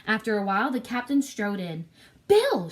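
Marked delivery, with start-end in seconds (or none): 2.3-2.7 sudden high shout of a name. A narrator's reading. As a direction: call the name out sharp and loud. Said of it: "shouted the stranger".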